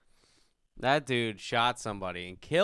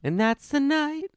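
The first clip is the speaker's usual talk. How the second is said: high silly voice; 14 semitones higher than usual. Pretend. High drunk voice.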